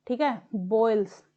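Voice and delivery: usual speaking voice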